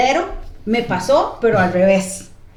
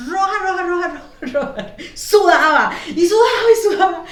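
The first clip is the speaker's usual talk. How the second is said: high, loud, laughing repeats; laughing exaggeration; 7 semitones higher, 5 dB louder than usual. Real, not acted. Describing the sweating lady to friends, laughing. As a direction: pile them on for comedy. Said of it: "exaggerating how red she was".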